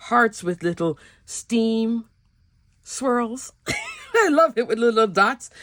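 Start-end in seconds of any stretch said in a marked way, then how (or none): 3.6-4.1 short high giggle; a bright little laugh at yourself; delighted giggle. Real, not acted. Laughs at her own stamp. 4.1-5.5 said through a laugh; giggly and pleased. Real, not acted. Laughing while showing the stamp.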